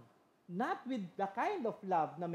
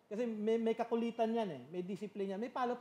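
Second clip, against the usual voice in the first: ordinary talk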